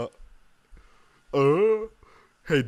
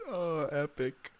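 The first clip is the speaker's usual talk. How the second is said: ordinary delivery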